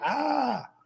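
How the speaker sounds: put-on a boy's test shout, held back in volume; mock child's yell. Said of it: "el chiquillo chilla". (grito fingido de niño, agudo y alargado)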